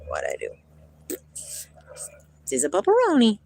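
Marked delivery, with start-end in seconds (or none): 0.1-1.2 whispered low aside. Real, not acted. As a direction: breathe it out under your breath. Startled aside.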